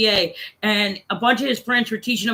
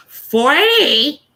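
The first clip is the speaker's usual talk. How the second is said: high, loud, drawn-out disbelief; incredulous little kid; 4 semitones higher, 6 dB louder than usual. Retold or imitated. Little kid shocked at the adult's age, incredulous. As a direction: squeal it as an astonished kid's question. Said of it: "He goes".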